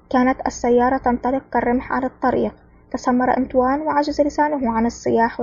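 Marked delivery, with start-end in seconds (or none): none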